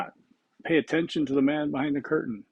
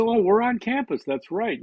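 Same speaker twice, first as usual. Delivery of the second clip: sudden realization; 9 semitones higher than usual. Retold or imitated. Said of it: "I said".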